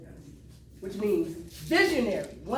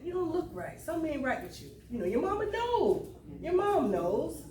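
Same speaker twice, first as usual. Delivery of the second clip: imitated concerned mom; 6 semitones higher than usual. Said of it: "Mom said".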